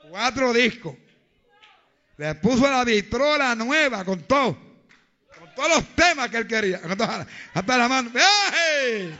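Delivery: loud fiery sermon, shouting the faith; preaching at full force. Acted. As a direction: proclaim it at full voice to the congregation.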